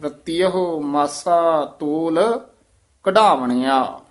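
ਰਤੀਅਹ firm and tight, the line slow; ਰਤੀਅਹ tight, ਮਾਸਾ ਤੋਲ slow. Shown as a demonstration. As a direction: press hard on ਰਤੀਅਹ, then a pause before ਕਢਾਵਣਿਆ. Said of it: "ਰਤੀਅਹ ਕੱਸ ਕੇ ਬੋਲਣਾ".

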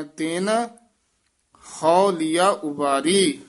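ਤਿਨ said alone, then a long break; pause after ਤਿਨ. Shown as a demonstration. Teacher demonstrating the break after ਤਿਨ. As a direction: stop after ਤਿਨ before ਹਉ. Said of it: "ਤੇਨ ਪਦ ਤੇ ਯਮਕ ਲਾ ਕੇ ਬੋਲਣਾ ਹੈ".

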